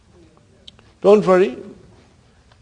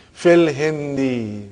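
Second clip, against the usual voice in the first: slow drill; much slower than usual. Shown as a demonstration. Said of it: "teacher drills the phrase".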